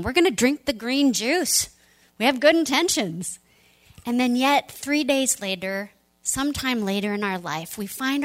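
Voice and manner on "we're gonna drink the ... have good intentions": mock upbeat ad voice. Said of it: "it's like the cover of the magazine".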